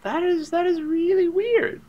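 high, loud, drawn-out disbelief; slow, exaggerated high-pitched bewilderment; weirded-out reaction. Retold startled thought at spitting.

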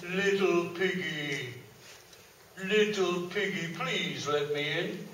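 sugary, coaxing wolf asking nicely; overly polite big bad wolf. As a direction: sweet-talk the pig through the door. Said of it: "in his most polite voice".

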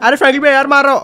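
normal talking voice

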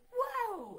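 high, loud playful whoa; mock whoa. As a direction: ham up a surprised whoa as the son bumps into the display. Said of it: "ran into it and was like".

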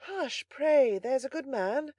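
high, light female pleading; gentle young lady. A narrator's reading. Young lady quieting a bawling servant, gentle high voice. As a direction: hush him sweetly but with urgency. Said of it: "as soft and gentle as the voice".

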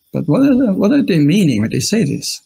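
normal talking voice

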